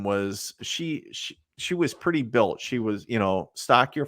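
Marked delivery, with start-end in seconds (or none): none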